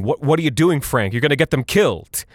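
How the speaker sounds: high, loud frantic shout at her husband; mother shouting. Acted. Mother at the door shouting at the father, frantic and loud. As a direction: shout it in panic, voice cracking upward. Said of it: "my mom was standing at the door shouting".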